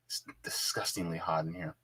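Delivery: breathless whisper about the heat; whispered complaint. Real, not acted. Host whispers to chat about the hot room. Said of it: "boy, it's hot in here".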